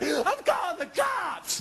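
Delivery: drawn-out high yelled threat; high slow shout. Retold or imitated. Threat during fight.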